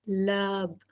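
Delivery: लब eased out gently with a long vowel; slow, drawn-out lub. Shown as a demonstration. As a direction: stretch लब out long and soft. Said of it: "लब कैसे करता है?".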